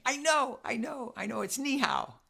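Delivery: high, eager know-it-all voice; eager child blurting the answer; imitating eager kids. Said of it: "you saying".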